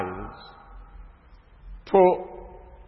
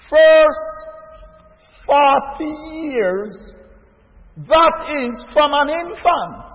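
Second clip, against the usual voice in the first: raised, slow preacher's build to the congregation; preaching; 6 semitones higher, 5 dB louder, much slower than usual. Acted. Preacher rousing his brothers and sisters, slow and raised. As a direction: lean into every phrase like a pulpit sermon. Preaching about Moses in Egypt.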